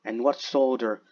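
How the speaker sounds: slow, drawn-out dramatic poem reading; poetry recitation. Acted. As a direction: recite it solemnly, stretching each phrase. Recites Blake's poem aloud.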